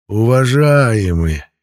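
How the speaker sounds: slow, loud, drawn-out vowels; vampire drawing out the A. A narrator's reading. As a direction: stretch every "а" in "Уважаемые" long. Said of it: "Он странно растягивал букву «А»".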